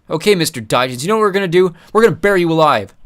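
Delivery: loud menacing threat; threatening. Acted. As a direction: threaten him loudly. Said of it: "more impulsive of the duo".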